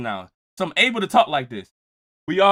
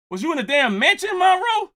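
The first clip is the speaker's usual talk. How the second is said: shout; 10 semitones higher than usual. Real, not acted. Host shouting a question at chatter Monroe. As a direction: yell across the room at him.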